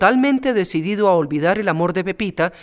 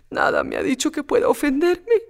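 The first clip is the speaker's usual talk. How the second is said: put-on higher, fragile young voice; Pepita, tearful; 9 semitones higher than usual. Pepita answering her maid, tearful and higher.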